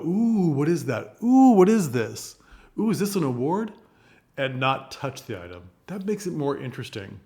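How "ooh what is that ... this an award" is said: higher, louder, cooing "Ooh"; fascinated hostess ladies. Shown as a demonstration. Showing the funnier gossipy ladies, cooing and louder. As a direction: coo over each item with delight. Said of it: "it's funnier to have them go".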